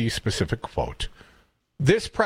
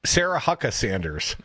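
normal talking voice

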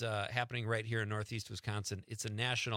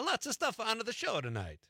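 high, lilting hint of Italian accent; accidental Italian accent; 10 semitones higher than usual. Real, not acted. Host announcing tonight's show, slipping into an Italian accent. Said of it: "did it again".

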